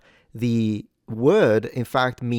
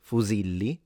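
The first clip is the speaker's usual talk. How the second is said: fusilli with the middle syllable si stressed; 3 dB quieter than usual. Shown as a demonstration. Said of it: "the stress is on the second syllable".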